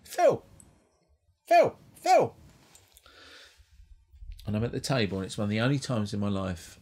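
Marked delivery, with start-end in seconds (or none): 0.0-2.4 much higher, loud shouting of a name; high excited hail; calling out. Retold or imitated. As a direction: yell across the room to get attention. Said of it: "[name] ... is going".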